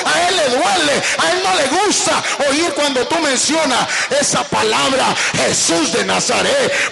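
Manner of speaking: loud, fervent pulpit preaching; preaching at full force. Acted. Preacher thundering to church about Satan, fervent. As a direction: preach it out hard to the congregation.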